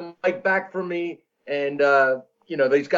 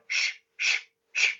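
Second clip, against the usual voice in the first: airy shh shh shh, hushing a noisy talker; mimicking the chess players' shushing; imitated shushing; 6 dB quieter, slower than usual. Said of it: "They're going".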